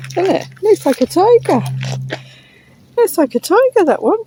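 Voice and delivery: high, sing-song voice to a dog; high pet-talk voice. Real, not acted. Talking to a dog on walk.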